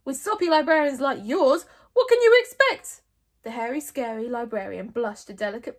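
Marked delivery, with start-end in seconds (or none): 0.0-3.0 high, loud comeback; scornful retort. A narrator's reading. Stoic mocking Mogadon's librarian, scornful shout. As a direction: hurl the scorn back at him.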